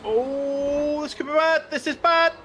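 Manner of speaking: sudden high alarmed pitch; rising alarm at a crash. Real, not acted. Alarmed commentator seeing a crash.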